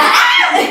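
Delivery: high, loud shriek breaking into laughter; mock scream. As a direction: shriek playfully, nearly laughing. Last scream of the exchange. (grito agudo entre risas)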